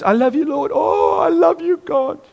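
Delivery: hammed-up sugary devotion to the Lord; mock worshipper. Mocking shallow worship.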